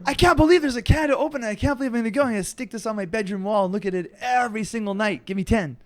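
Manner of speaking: high, fast gushing fan; excited kid voice. Retold or imitated. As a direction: squeal like a thrilled kid. Acting an excited kid's reaction.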